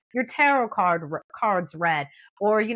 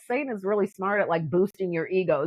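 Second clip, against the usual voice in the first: ordinary delivery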